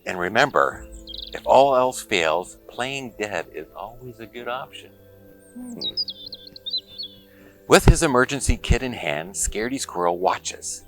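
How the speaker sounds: ordinary delivery, nothing marked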